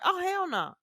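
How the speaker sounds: high, exasperated groan; mock horror. Mother retelling seeing the mess, high horrified. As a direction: react to an awful sight. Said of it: "I'm like".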